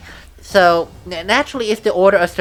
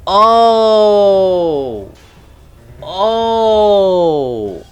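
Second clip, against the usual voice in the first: long drawn-out "oh" of discovery; dawning realization; 3 semitones higher, 4 dB louder than usual. Real, not acted. Player suddenly grasping who the villagers are, surprised. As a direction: let the penny drop, stretching each one out.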